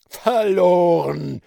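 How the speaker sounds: loud, slow, hoarse cry of doom; pirate chief screaming hoarsely as all is lost. A narrator's reading. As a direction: rasp out the word in despair. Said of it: "schrie er mit heiserer Stimme".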